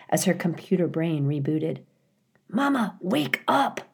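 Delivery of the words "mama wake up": put-on anxious young gosling. A narrator's reading. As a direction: plead like a little one calling mother. Young goose tries waking mother.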